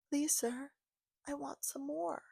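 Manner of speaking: pleading orphan. Acted. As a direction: beg like a hungry little orphan. Quoting a begging orphan.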